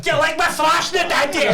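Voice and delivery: mock sexy shout. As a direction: yell it like a cheesy flirt. Mock dirty talk, shouted.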